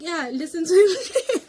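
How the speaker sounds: high, loud, slow laughing through tears; laugh-cry as you beg; teary laughing plea. Retold or imitated. Pleading with the robber at gunpoint, high laughing half-sob.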